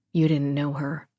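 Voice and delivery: usual speaking voice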